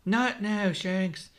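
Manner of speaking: high, mildly strained protest; choked protest. A narrator's reading. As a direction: protest as if being squashed. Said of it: "Luffy chokes".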